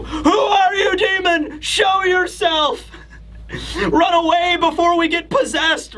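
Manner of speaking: high, shouted panic; terrified Road. Retold or imitated. Road scared of the voice, high frightened shouting. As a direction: yell at the unseen demon in fright.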